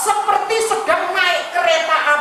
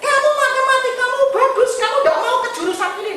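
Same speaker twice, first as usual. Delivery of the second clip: put-on higher, fast, eager question to his child; father rushing to suggest Bible school, higher and fast; coaxing father; 11 semitones higher, faster than usual. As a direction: push the option quickly and hopefully.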